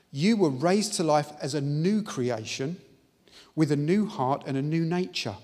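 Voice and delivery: full-force pulpit preaching; fervent preaching. Acted. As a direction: proclaim it to the congregation.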